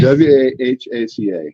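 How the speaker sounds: W A H A C A, slow, with H said as American "aitch"; slow spelling, American H. Shown as a demonstration. Host repeating the chef's spelling, slowly. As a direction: space the letters out with an American H. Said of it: "I say H".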